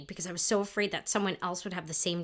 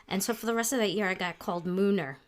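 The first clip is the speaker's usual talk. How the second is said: ordinary talk, nothing marked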